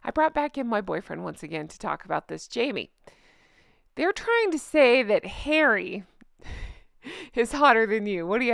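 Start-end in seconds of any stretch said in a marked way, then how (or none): none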